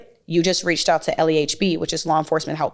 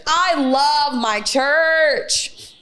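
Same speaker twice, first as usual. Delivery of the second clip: gushing; 9 semitones higher, much slower than usual. Real, not acted. Gushing about church.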